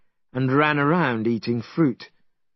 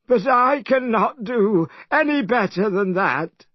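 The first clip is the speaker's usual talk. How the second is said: complaining; 9 semitones higher than usual. A narrator's reading. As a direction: sulk at Monkey. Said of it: "complained Pigsy".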